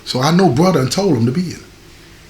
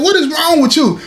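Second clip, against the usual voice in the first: higher, sharp sisterly scold; 10 semitones higher than usual. Retold or imitated. Sister at the prison visit demanding what's wrong with him, high and scolding. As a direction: call him out like a puzzled big sister.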